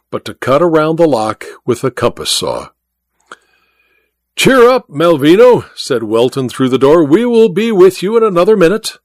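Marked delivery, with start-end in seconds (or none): 4.3-5.7 high, loud cheery call; raised voice, pitched high; calling through door. A narrator's reading. 7.0-9.0 high-pitched reassuring call; raised pitch, carrying to the next room; calling through door. A narrator's reading.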